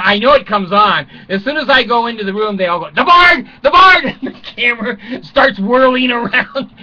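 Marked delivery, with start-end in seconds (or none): 2.9-4.0 high, loud cheering call of the crowd; mock crowd calling his name; excited crowd shout. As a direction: shout it out like fans spotting a star. Crowd greeting him on camera.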